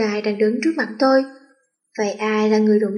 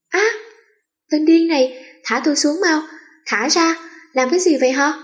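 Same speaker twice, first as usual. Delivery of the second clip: yelling while being carried off; screaming protest; 5 semitones higher than usual. Acted. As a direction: shriek at him to let her go. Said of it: "Tôi kêu gào đánh hắn".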